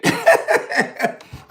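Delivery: airy burst of laughter, high up; breathy high laugh. Real, not acted. Laughing at his own joke about robbing a rich friend, airy high laugh. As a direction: laugh out at your own punchline.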